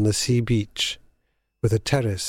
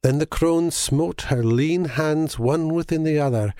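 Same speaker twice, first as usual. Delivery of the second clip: plain reading voice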